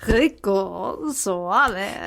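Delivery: put-on slow, rolled Latin-accent '[name]'. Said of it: "Is he Latin? Oh, yeah".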